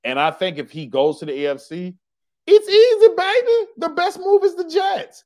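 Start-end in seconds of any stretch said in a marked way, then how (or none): 2.5-5.2 high-pitched, loud burst of conviction; hyped declaration. Real, not acted. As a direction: shout the hot take way up high. Excited hot take on the Jets.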